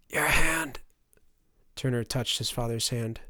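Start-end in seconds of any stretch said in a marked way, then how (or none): 0.1-0.8 higher, strained, rasping request; dying rasp. A narrator's reading. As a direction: ask with a failing, burned throat. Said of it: "said a rasping voice".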